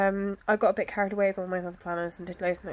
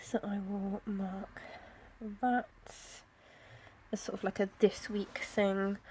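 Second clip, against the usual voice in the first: whispering; 6 dB quieter than usual. Real, not acted. Quiet aside while planning.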